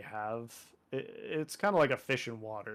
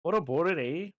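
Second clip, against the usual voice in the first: mock Canadian accent. Mocking Canadian stereotypes to friend.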